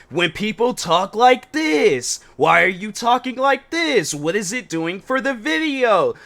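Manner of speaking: high, drawn-out put-on YouTuber delivery; mocking fake voice. Reactor mimics people who put on a voice for videos, mocking fake voice. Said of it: "they put on, like, this, this fake voice".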